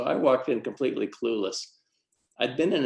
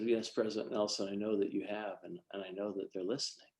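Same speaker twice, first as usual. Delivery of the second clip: quiet humble reply; 10 dB quieter than usual. Retold or imitated.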